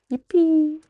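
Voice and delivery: high, bright little cheer of relief; real delight. Real, not acted. Streamer cheering as the internet returns, high and happy. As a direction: celebrate the connection coming back.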